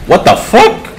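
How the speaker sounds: sudden shocked cry of What the fuck?; startled outburst. Real, not acted. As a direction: pitch leaps high on a shocked What the fuck?. Startled by sudden game event.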